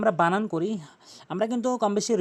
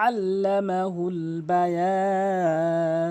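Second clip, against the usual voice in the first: slow chanted verse, vowels drawn out; Quran recitation; much slower than usual. Shown as a demonstration. Teacher resumes chanting the verses. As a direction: recite melodically and slowly. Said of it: "তিন আলিফ টেনে পড়তে হবে বায়ান".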